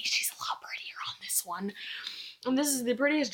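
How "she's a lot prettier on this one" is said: whispered secret aside; confiding whisper. Real, not acted. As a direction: lean in and whisper it like a confession. Confiding an honest opinion aside.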